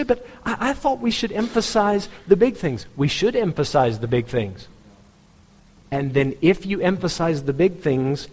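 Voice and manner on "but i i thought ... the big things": much higher mimicked objector; protesting churchgoer. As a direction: pitch it up like a churchgoer pushing back.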